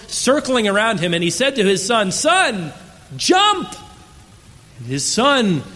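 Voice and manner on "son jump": a much higher, louder shout up to a window; father's desperate holler. Acted. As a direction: yell up to the boy at the burning window. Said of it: "began to holler out for his son".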